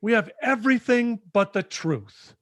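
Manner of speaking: high, loud, drawn-out demand for the truth; retold wife yelling at her husband. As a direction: yell it at him, pleading and furious. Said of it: "she finally just yells at him".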